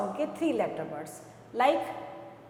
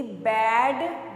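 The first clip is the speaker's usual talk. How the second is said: बैड drawn out slow and louder, ऐड sound stretched; stretched slow bad; 5 dB louder, much slower than usual. Shown as a demonstration. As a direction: stretch out बैड so the ऐड ending rings. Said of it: "देखो मैंने ऐड बोला ना". (बैड को धीरे खींचकर, ऐड पर ज़ोर)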